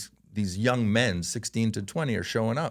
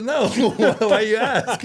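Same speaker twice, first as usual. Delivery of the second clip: high, loud words through laughter; laughing retelling; 12 semitones higher, 8 dB louder than usual. Retold or imitated. Dad answering son's bald eagle question, told laughing. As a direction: barely hold the laugh in while quoting.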